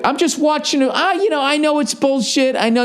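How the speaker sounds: high, whiny mock of a hypocritical fan. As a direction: sneer it out like a fan pretending not to care. Mocking fans' excuse.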